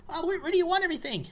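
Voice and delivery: put-on high, fast eager kid; bright little delivery boy; young elf kid. Plays young elf Dipley.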